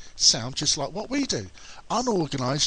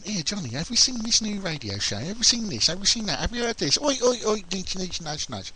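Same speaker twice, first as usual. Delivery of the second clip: high, eager pestering voice; mock excited nagging; people nagging; 6 semitones higher than usual. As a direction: mimic people badgering him. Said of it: "I get a few people going".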